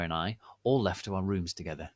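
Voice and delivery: plain reading voice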